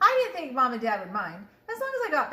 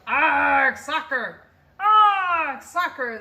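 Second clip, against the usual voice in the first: loud, higher growling cheer; pirate yell; 4 semitones higher, 10 dB louder, much slower than usual. A narrator's reading. Captain and crew cheering at soccer, loud yell. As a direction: bellow it as you kick the ball. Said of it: "Braid Beard kicked the ball and yelled".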